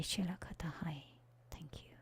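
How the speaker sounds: soft unvoiced whispered chant. Facilitator ending the meditation in a whisper. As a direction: let the strange syllables fade to breath. Whispered light language closing.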